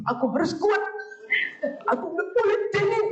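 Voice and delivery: high, strained imitation of a child fighting tears; holding back tears. Child repeating mama's ban on crying to themself, holding back tears. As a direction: act out a child forcing back a cry, pitch climbing. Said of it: "Tapi dia mau nangis".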